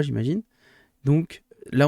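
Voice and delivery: normal talking voice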